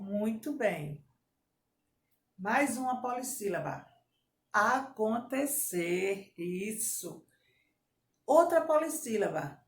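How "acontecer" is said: slow, segmented "Acontecer"; syllable-by-syllable dictation. Shown as a demonstration. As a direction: separate each syllable of "Acontecer". Teacher divides word into syllables.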